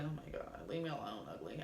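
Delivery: low, muttered mock disgust; inner grumble. Her private thought when a man approaches, muttered low. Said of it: "in my head, I'm just like".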